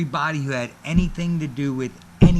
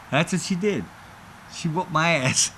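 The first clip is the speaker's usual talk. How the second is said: ordinary talk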